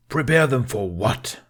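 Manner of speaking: a father losing his temper; exploding in anger. A narrator's reading. Stoic exploding at his stubborn son, furious. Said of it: "Stoic exploded".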